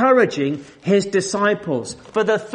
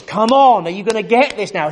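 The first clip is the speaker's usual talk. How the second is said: higher, louder, fast exasperated urging; impatient Jesus; 5 semitones higher, 6 dB louder than usual. Retold or imitated. Preacher imitating Jesus hurrying his disciples, exasperated. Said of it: "we can imagine Jesus almost doing this".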